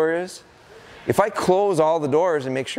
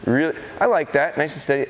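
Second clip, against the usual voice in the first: ordinary talk, nothing marked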